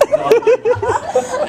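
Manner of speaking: squeaky high, slow sing-song; mocking echo. Storyteller mocking the girl's rejection, high falsetto drawl. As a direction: stretch it out in a falsetto mock.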